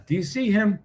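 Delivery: high-pitched put-on voice; mock onlooker. Streamer mimicking people reacting to the news, high mock voice. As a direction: pitch it way up, imitating someone reacting.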